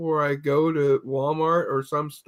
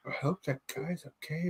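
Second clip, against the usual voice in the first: hushed worry; 15 dB quieter than usual. Retold or imitated. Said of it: "you hear a couple of associates go".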